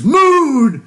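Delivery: drawn-out, high, loud shout; Piccolo. Retold or imitated. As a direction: imitate an anime character bellowing one long word. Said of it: "Piccolo goes".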